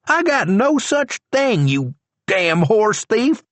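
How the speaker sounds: deputy insulting the prisoner; Charlie's voice. A narrator's reading.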